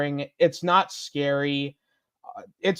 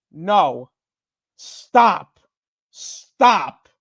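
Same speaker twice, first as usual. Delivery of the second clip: louder, firm stop, fed up with the writers; 6 dB louder, much slower than usual. Real, not acted. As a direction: shut it down, exasperated. Exasperated rant at the writers.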